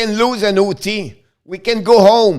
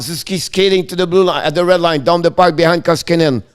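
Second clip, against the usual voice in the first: play-by-play. Acted. Live play-by-play call.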